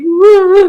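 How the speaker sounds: high, loud mock bawling; hammed-up wailing cry; imitated sobbing wail. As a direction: act out a man breaking into sobs. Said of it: "he's sitting there sobbing".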